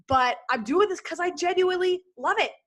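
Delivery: ordinary talk, nothing marked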